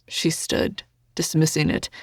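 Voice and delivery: usual speaking voice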